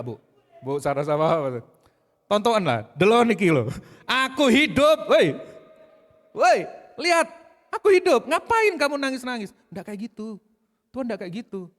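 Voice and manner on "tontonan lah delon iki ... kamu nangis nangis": much higher and loud, mocking "I'm alive"; brash Javanese-style Jesus. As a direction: shout it like a cocky friend. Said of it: "pakai bahasa Jawa lebih enak". (Yesus gaya Jawa kasar, teriak tinggi)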